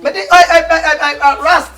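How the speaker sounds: loud, stammering outburst. Real, not acted. Man stammering loudly to his partner. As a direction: blurt it out loudly, tripping on the words.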